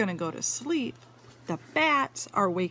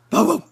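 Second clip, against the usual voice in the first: a loud, high bow bow; loud dog bark; 7 semitones higher, 11 dB louder than usual. Acted. Reading to a child, barking like the big red dog.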